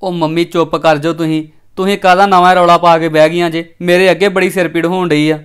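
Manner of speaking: loud, exasperated telling-off; angry outburst. Acted. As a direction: shout them down, fed up with the noise. Son snaps at quarrelling mother.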